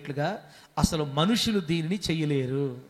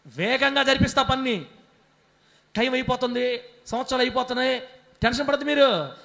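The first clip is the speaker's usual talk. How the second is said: booming, higher voice of God rebuking worry; God's voice; 4 semitones higher, 4 dB louder than usual. Acted. Pastor as God tells the congregation not to grieve, booming loud. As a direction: thunder out God's promise to a fretting people.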